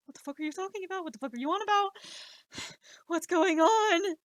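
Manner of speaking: exasperated outburst. Retold or imitated. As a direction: blow up in baffled disbelief. Baffled outburst at kid.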